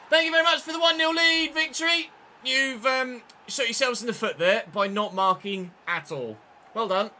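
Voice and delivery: loud sarcastic taunt at the defenders; sarcastic thanks. Real, not acted. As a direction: rub it in with fake gratitude. Mocks opponents after conceding.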